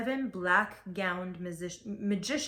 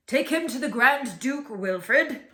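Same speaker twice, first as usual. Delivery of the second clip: loud, slow royal command; commanding king; 4 semitones higher, 8 dB louder than usual. A narrator's reading. The king commands Bartholomew be taken away, loud and slow. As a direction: order it like a king on his throne. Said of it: "commanded the king".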